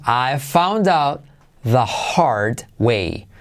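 "I found out the hard way" very slow and even; slow demo. Shown as a demonstration. Teacher models the phrase slowly to students. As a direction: draw each word of "the hard way" out. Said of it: "slow teaching model".

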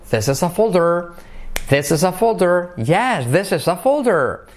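high linked model; linked with high peak. Shown as a demonstration. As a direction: link it and lift folder. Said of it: "Todo junto".

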